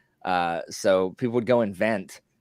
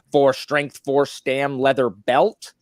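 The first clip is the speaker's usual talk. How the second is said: a touch louder and higher meme voice; lightly voiced meme quote; 7 semitones higher, 6 dB louder, much slower than usual. Host quotes a WoW soundboard meme, lightly voiced. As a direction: call it out like a raid meme. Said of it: "they'd just hear".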